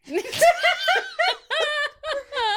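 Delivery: loud, high, long cackle; big laugh. Real, not acted. Hosts crack up at the saved-up pun, loud laughter.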